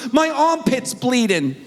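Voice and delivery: put-on high, slow, drawn-out kid's wail; imitated frantic child. Daughter telling dad about her injury, mock frantic wail. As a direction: whine it out like a panicked little girl.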